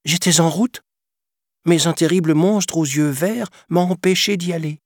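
voix de pantin aiguë; petit garçon menteur. A narrator's reading. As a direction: invente une excuse d'enfant. Pinocchio ment à la fée. (voix d'enfant aiguë)

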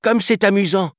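very high, gleeful call; delighted child. A narrator's reading. Boy calling to his new friend, delighted. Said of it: "lança-t-il à son nouvel ami".

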